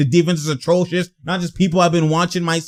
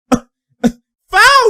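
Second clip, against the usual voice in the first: high yelled foul; yelling foul at the screen, high and loud; shouted call; 5 dB louder than usual. Real, not acted. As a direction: yell it at the screen.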